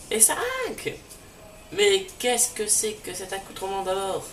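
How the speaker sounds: scolding that climbs very high; squeaky outraged old professor; high indignant scolding. Acted. The professor scolds the villains in a high outraged voice.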